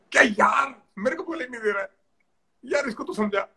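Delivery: teasing the captain with a grumbling teammate impression; mock complaint. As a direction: imitate a sulking bowler griping.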